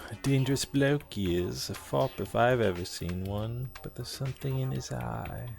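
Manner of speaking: lightly voiced British townsman warning of danger; a hint of a cockney local, playing it ominous; British accent. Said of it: "gotta be a British accent".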